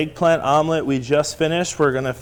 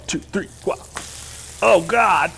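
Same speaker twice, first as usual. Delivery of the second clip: normal talking voice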